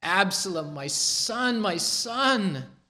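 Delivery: high, keening cry of a father for his son; grieving lament. Acted. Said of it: "Twice we hear his voice".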